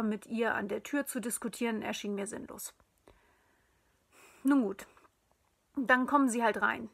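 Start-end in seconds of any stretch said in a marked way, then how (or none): 4.4-6.9 laut und unwirsch nachgegeben. A narrator's reading. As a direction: widerwillig und gereizt einlenken. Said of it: "erwiderte ich unwirsch". (unwirsch zum ungebetenen Gast)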